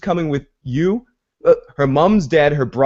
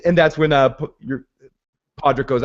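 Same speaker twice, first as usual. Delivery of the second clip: ordinary delivery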